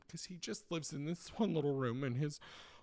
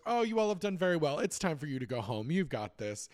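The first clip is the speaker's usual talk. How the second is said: a hint of a doddering old boss, quick and pleased; Mister Grace voice; 4 semitones higher, 5 dB louder than usual. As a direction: lightly play the old owner praising his staff. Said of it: "he just goes".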